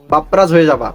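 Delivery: ordinary delivery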